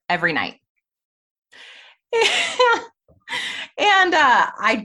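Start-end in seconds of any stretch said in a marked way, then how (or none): 2.1-2.9 short embarrassed laugh. Real, not acted. Laughing at her own confession.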